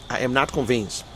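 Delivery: subdued doubt. Real, not acted. Doubter restating position to viewers, subdued doubt. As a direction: settle quietly into your doubt. Same words repeated as low aside.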